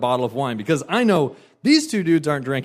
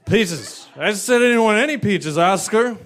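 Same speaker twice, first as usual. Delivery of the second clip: shrill-free mock fury, higher and louder; mock angry rant; 8 semitones higher, 4 dB louder than usual. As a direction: drunk woman berating her waiter. Drunk woman rants.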